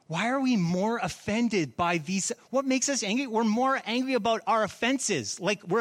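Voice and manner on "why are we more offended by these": ordinary talk, nothing marked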